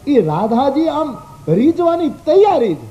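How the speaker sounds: ordinary talk, nothing marked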